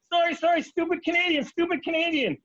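hammed-up sorry-sorry Canadian; panicked apologetic Canadian. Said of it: "I'm like".

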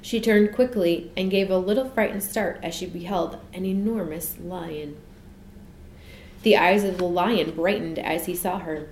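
ordinary delivery, nothing marked

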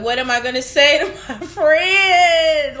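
rising, drawn-out loud wail; retold distraught wail. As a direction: act out wailing helplessly for a grieving friend. Distraught reaction to death, retold.

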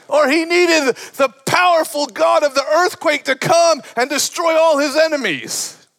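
high-pitched, impassioned preaching shout; full-force preaching. Real, not acted. Preacher tells congregation of Elijah's need for rescue, impassioned preaching.

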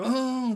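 high, loud "mmm" of a big stretch; stretching groan. Shown as a demonstration. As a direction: let out a strained waking-up stretch noise. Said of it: "ちょっと声出てるのもいいな".

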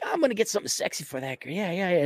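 fast, eager yelps of joy; giddy excited. Retold or imitated. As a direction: rush it out like a kid with a gift. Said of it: "you're like".

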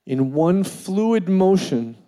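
usual speaking voice

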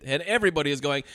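ordinary talk, nothing marked

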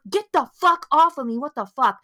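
acted angry. Host voices how the woman should react to Gary, angry. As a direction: fight him off with the words. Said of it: "or, like, angry".

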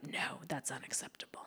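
whispered, mocking imitation; mock ex-boyfriend. Imitating the boyfriend's ruling, whispered and smug. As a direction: breathe it out like a smug verdict. Ex-boyfriend forbids her riding.

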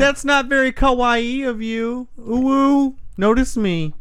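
put-on high-pitched anime-girl pleading; cutesy anime voice. Baffling the host with anime words, in a cutesy voice. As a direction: play a cute anime character, high and coy. Continues mock anime talk.

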